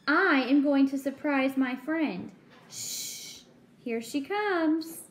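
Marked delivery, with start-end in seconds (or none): 2.7-3.4 unvoiced shush; hushing whisper. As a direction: hush someone before the surprise. Hushing before friend arrives.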